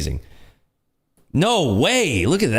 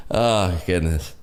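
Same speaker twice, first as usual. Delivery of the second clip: ordinary talk, nothing marked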